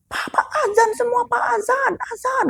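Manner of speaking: high shouted call to the men; shouting. Retold or imitated. Father shouting to pallbearers to recite azan, high shout. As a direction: shout across at them to join in. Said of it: "teriak tuh ke mereka".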